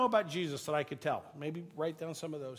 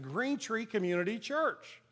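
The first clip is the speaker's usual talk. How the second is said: name spelled out slowly and higher; slow, emphatic repeat; 5 semitones higher, slower than usual. Retold or imitated. Customer repeats church name to cashier, slow and emphatic. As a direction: repeat it deliberately for a clerk who keeps asking. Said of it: "I said it about like that".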